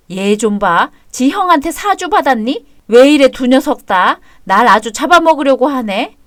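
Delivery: indignant. Acted. Mother quarrels with her son.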